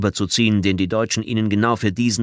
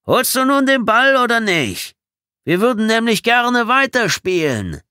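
calling out; 7 dB louder than usual. A narrator's reading. Trader Vic wanting to resume play, shouting. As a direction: holler impatiently from far away. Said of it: "rief Trader Vic erneut".

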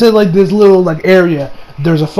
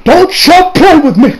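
slower, higher, louder, each word pressed; mock warning, stressed; 9 semitones higher, 5 dB louder, slower than usual. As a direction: drive the warning home, mock furious. Repeated warning, stronger.

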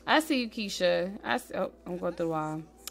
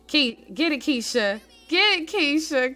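cheering; 6 semitones higher, 6 dB louder than usual. Real, not acted. Cheering a dancing Sim.

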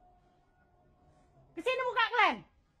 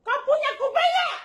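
real angry demand; 5 semitones higher than usual. Real, not acted. Woman demanding again whether the neighbours have ears, rising anger. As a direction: fling it at them, fed up.

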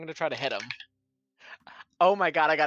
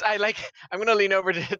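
ordinary talk

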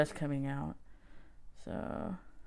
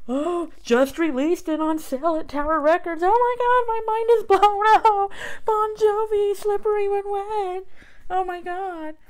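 high, loud gushing fake awe; mock amazement; 12 semitones higher, 6 dB louder than usual. Reading old Tower ad, hammed-up gushing. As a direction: gush over the catalog like it's thrilling.

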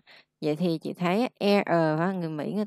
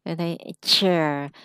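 usual speaking voice

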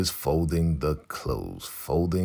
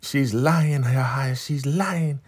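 high mock Marva voice; 9 semitones higher, slower than usual. Host imitating Marva protesting her innocence, high and whiny. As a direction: pitch way up, a whiny denying Marva.

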